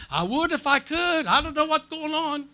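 hammed-up tearful protest, pitched high; helpless protest. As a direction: wail back helplessly, laugh at the end. Helpless reply to scolding wife.

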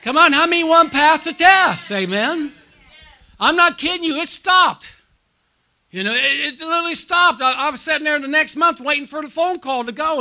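high, loud preacher's cry; preaching at full force. Real, not acted. Preacher rousing the congregation, loud and high.

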